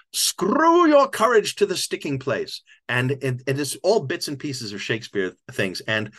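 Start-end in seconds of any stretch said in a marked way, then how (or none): 0.1-2.6 loud, high, slow mock-Shakespearean boom; hammy old actor. Old actor blurting a Shakespeare line, grand hammy boom. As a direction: declaim it grandly like a desperate ham. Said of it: "He goes".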